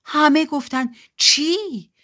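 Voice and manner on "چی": high, surprised exclamation; astonished. Acted. Everyone reacts to Dad agreeing with Billy, high astonished question. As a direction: blurt it in disbelief.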